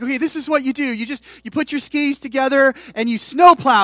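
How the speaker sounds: frustrated instructing. Retold or imitated. Dad instructing frustrated son skiing.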